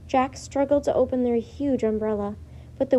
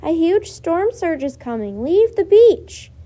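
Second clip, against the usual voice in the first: urgent shout; 5 semitones higher, 9 dB louder than usual. A narrator's reading. Girl shouting at beachgoers to leave, loud and urgent. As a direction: yell the warning so the whole beach hears. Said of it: "shouted Annie".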